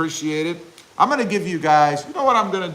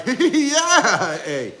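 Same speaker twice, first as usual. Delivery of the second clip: put-on laughing Canadian accent; 8 semitones higher, 3 dB louder than usual.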